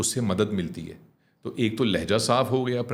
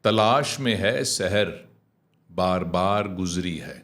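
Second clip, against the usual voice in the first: slow, measured poetry with falling cadence; clear verse recitation; 5 semitones lower, slower than usual. Shown as a demonstration. Teacher models the next verse for the student, slow clear recitation. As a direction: give each word its full length. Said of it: "تو میں ایک جملہ بولتا ہوں".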